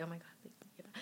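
hushed quote. Retold or imitated. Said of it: "Never through this journey did I say".

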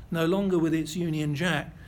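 normal talking voice

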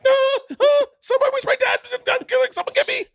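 frantic high cry for dad; panicked pleading. Retold or imitated. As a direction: beg for help in terror. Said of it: "real panic".

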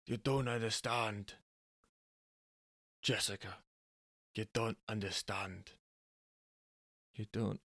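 put-on Angus in a Scottish accent, soft; quiet, earnest Scottish husband; a hint of Scottish brogue. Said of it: "I've got stuck in Scottish".

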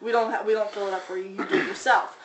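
usual speaking voice

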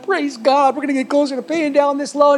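imitating the pastor's grateful delight; praise God relief. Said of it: "And the pastor's like".

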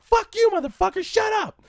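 put-on high, loud kid yell; mock shouting match. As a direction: escalate the yelling. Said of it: "I'm like".

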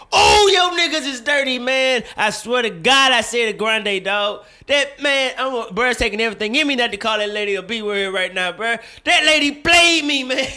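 indignant rant. Real, not acted. Ranting about Starbucks overcharge.

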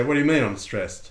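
loud, indignant mock protest; mock indignant denial. Teacher playing a stressed person in denial, mock indignation. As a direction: protest loudly, as if absurdly accused.

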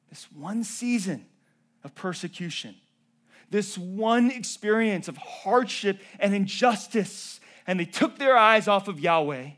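loud, high, driving sermon voice; impassioned pulpit delivery; full-force preaching. Acted. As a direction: preach it hard to the church.

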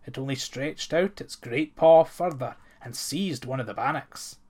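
plain reading voice

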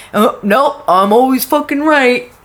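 high, cocky know-it-all; smug know-it-all voice. Retold or imitated. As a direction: play the smug guy who's always right. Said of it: "some asshole that just thinks that".

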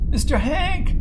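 put-on high, loud frightened boy call; a child calling out in panic; boy crying out. A narrator's reading. Boy calling Hank after the crash, high loud panic.

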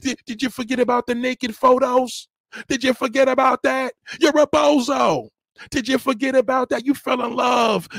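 high, loud scolding; angry rant. Real, not acted. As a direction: yell at her like she's shamed you. Ranting at her, shouting.

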